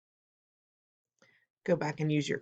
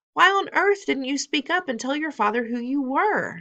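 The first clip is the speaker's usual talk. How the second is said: higher, louder scolding; exasperated grandmother; 10 semitones higher, 5 dB louder than usual. Acted. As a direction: scold the boy.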